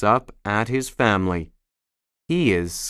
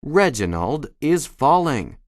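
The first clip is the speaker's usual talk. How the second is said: normal talking voice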